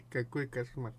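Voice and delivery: laughing through the words, slow; chuckling, amused disbelief. Real, not acted. Laughs at the phobia.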